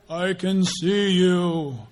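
loud, slow mock-divine voice; booming TV God. God as TV people play him, watching you, in a slow boom. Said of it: "Only people on T V talk like that".

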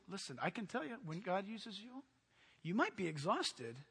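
ordinary talk

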